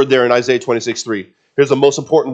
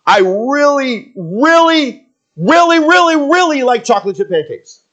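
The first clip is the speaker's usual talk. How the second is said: put-on gushing kid; 11 semitones higher, 4 dB louder than usual. Preacher imitates kids repeating a word, high gushing kid voice. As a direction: imitate a kid stretching every "really". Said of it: "Your kids say it".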